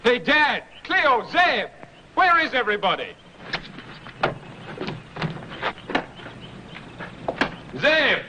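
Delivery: calls out high across the yard; hailing the house from outside; shouted greetings on arrival; calling out. Real, not acted.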